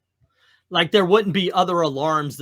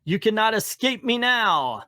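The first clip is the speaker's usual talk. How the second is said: raised, commanding comic villain; menacing villain; 8 semitones higher, 3 dB louder than usual. Acted. Baron Mordo threatening the trapped Strange, raised menacing voice. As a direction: loom over him with threats.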